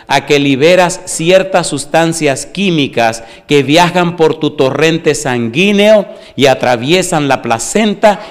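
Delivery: loud, driving sermon delivery; full-force preaching. Acted. Pastor preaching to the congregation about mothers and babies, loud and driving. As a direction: preach it out to the whole church.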